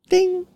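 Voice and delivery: high sung-out doorbell ding; doorbell ding imitation.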